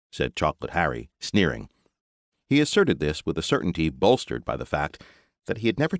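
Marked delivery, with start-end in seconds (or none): none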